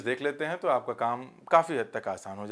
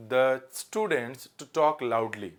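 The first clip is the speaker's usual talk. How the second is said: slow dictation; much slower than usual. Shown as a demonstration. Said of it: "इसका answer करें".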